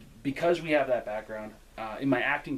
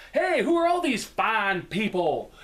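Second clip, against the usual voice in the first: drawn-out, raised hello, lightly hammed up; coworker's hearty greeting; 6 semitones higher, 4 dB louder, slower than usual. Retelling coworker's second entrance to tour guests, hammed-up hearty greeting. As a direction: greet the crowd slowly and loudly, in imitation.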